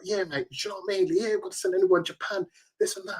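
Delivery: put-on Cockney accent hyping the prize trip; imitating a Cockney accent.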